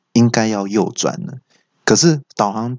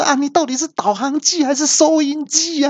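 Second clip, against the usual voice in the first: 拔高音调的夸张吐槽; 高八度假装抓狂; 模仿大叫. Retold or imitated. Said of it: "我就大叫说". (对导航机高八度夸张吐槽)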